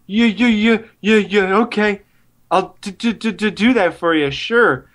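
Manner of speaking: fake stutter. Mocking a supporting actor's bad performance, fake stutter. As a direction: stammer on the first sound of the words, mocking. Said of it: "the guy with the fake stutter".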